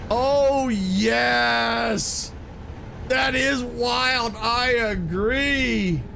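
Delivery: long, high cries of approval; thrilled. Real, not acted. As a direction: savor every word with delight. Thrilled by riding the monorail.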